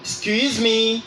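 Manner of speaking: "Excuse me" held long and high; voice going up. Shown as a demonstration. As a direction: lift "Excuse me" slowly to open a polite question. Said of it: "make your voice go up".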